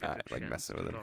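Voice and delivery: unvoiced aside; whisper. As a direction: whisper it slyly.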